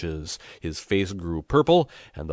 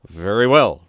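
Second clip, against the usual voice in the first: loud retort; 3 semitones higher, 12 dB louder than usual. A narrator's reading. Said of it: "replied Pepone".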